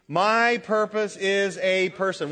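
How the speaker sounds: slow, firm declaration; with conviction. Shown as a demonstration. Preacher leading the congregation in saying it with conviction. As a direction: say it like a creed. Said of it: "That is to be said with conviction".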